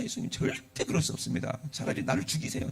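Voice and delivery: 숨소리로만 낮게 속삭이는 간절한 애원; 목소리 죽이고 매달리듯 속삭여; 속삭이듯 간청. Acted. Peter pleads with Jesus. (예수님께 차라리 죽이라고 비는 베드로, 속삭이는 애원)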